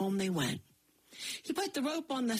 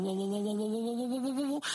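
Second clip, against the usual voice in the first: rumbling whoa; faster than usual. A narrator's reading. Ground splitting open.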